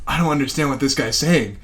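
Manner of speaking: low, soft aside to a coworker; muted-phone aside. Retold or imitated. Call taker turns to coworker with phone muted, quiet aside. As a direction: drop your voice, confiding confusion. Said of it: "I muted my phone and I'm like".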